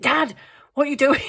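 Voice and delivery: high, loud and fast cry of disbelief; quick incredulous outburst; shocked. Retold or imitated. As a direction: catch him in the act, aghast. Shocked at dad eating nut.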